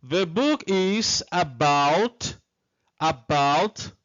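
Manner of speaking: drawn-out English sentence left hanging; careful, stretched teacher's reading; slow model reading. Shown as a demonstration. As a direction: read it slowly for students to complete. Teacher reads exercise slowly.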